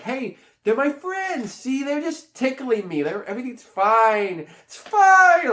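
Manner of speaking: high-pitched mock-innocent child, stretched words; exaggerated naive little-kid voice; Abby. Imitates Abby insisting friends are safe.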